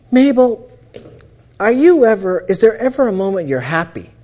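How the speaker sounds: pressing her, half pleading, half amazed; exasperated plea. Retold or imitated.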